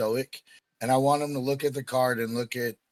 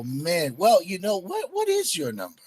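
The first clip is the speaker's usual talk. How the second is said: normal talking voice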